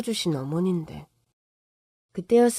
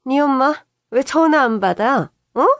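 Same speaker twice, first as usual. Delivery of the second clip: angry demand; 4 semitones higher, 5 dB louder than usual. Retold or imitated. Angry mother-in-law phone call.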